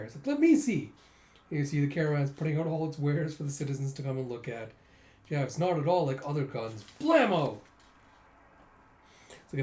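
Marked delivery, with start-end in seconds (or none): 0.3-0.9 much higher let me see; excited to look at the gun; eager. Retold or imitated. 7.0-7.6 loud blammo; mock gunshot. Gun sound.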